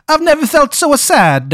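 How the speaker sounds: high, slow hammed-up Italian accent; mock Italian voice. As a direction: sob it out like a cartoon Italian in grief. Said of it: "stuck in a fucking Italian guy's voice".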